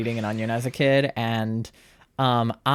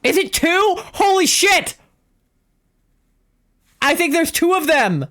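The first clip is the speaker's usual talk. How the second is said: very high, loud disbelief; shocked shriek; 5 dB louder than usual. Real, not acted. Player thinks there are two of them, shocked shriek. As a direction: shriek it, stunned by your luck. Shocked by rare find in game.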